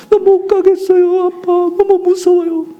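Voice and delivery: put-on high, slow, whimpering little boy; crying child. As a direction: plead like a terrified son at the dark mountain. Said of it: "울면서".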